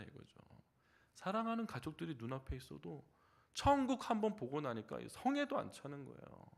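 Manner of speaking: ordinary talk, nothing marked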